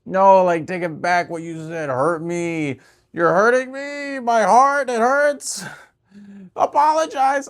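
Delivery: high, loud, slow wounded whining; mock hurt whine. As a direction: ham up a hurt demand for apology. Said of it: "So, when you're like".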